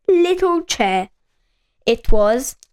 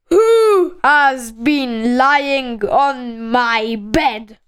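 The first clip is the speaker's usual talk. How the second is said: slow, booming bear demand; very loud Father Bear; much slower than usual. A narrator's reading. Father Bear asks who lay on his bed, very loud voice. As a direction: draw it out big and loud. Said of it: "in a very loud voice".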